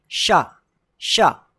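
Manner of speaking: शा as a clear "sha", falling; SH sound. Shown as a demonstration. Teacher modelling the letter for learners, clear SH. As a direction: say शा with a hushing sh. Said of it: "इसको क्या पढ़ते हैं".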